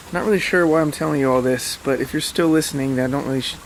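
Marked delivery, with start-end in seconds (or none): none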